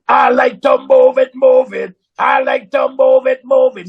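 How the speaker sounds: loud, rough monster growl singing; Cookie Monster voice. Retold or imitated. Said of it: "Do it with that Cookie Monster voice you got".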